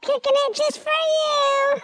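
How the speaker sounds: high chipmunk voice effect; squeaky cartoon voice. Voice changer pitched up.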